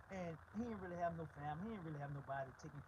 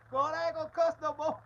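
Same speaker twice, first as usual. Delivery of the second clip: mock prayer; 10 semitones higher, 16 dB louder than usual. Mocking people praying to God.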